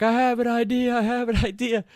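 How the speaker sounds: high, loud, silly voice with a laugh breaking in; giddy drunk voice; goofy drunk voice. Retold or imitated. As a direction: ham up a wasted, delighted idiot. Drunk self with light bulb.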